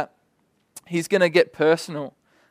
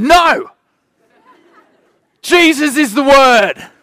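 much higher, very loud shout; imitated scream; 8 semitones higher, 10 dB louder, slower than usual. Boy screams at the teacher over dodgy teaching, imitated scream. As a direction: yell it like an outraged child. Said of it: "pointed at me and screamed".

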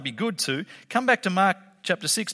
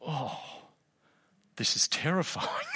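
awed hush; 3 semitones lower, 10 dB quieter than usual. Retold or imitated. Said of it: "They would have gone".

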